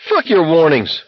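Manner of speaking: high-pitched defiant outburst; angry defiance. A narrator's reading. McVrees defies the soldiers' warnings.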